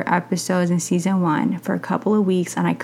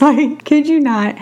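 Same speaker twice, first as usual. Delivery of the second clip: laughing; 7 semitones higher, 3 dB louder than usual. Real, not acted. Laughing while telling a story.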